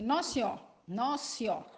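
"Nossa Senhora" said fast as "nossió"; clipped Nossa Senhora. Shown as a demonstration. Teacher showing students the clipped "Nossa Senhora". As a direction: swallow the end of "Nossa Senhora".

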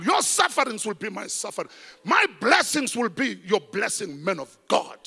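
loud, high preaching cry; full-force preaching. Acted. Pastor preaching loudly to the congregation. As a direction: thunder the vow at the church.

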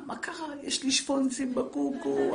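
a high, slow, laughing mock whine of a little girl; whiny crying girl. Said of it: "תראו אותה בוכה". (ילדה מתבכיינת בצחוק, גבוה ואיטי)

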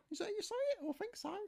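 his own aside slipping into the high niece falsetto; wrong voice. Shown as a demonstration. Uncle's aside wrongly in niece's high falsetto. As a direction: accidentally ask it in the niece's high voice. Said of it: "Oh, no, I've got wrong voice".

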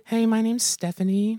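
high, slow put-on girly voice; girl voice. Friend tries a girl voice for a prank call. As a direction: pitch up and play a girl on the phone. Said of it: "pretend to be a girl".

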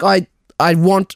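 ordinary talk, nothing marked

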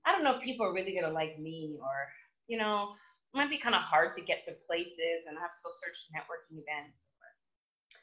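low, soft, anxious muttering; low, quiet self-doubt spiralling; doubting inner voice. Retold or imitated. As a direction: talk yourself out of it under your breath. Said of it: "that second thought when you're like".

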